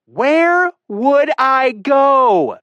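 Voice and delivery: high, very slow, sarcastic woman's voice; trapped woman answering him sarcastically; drawn-out sarcasm. A narrator's reading. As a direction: stretch it out in disbelief.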